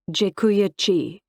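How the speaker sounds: halting, awkward Polish thank you; rough Polish attempt. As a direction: sound out "Dziękuję ci" hesitantly, like a first try at Polish. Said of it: "My attempt at thank you was rough".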